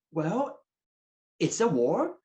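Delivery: normal talking voice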